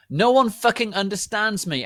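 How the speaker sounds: loud, high furious yell; angry shout. A narrator's reading. Draco yelling at the narrator, angry shout. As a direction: scream it like a misunderstood teen. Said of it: "he shouted angrily".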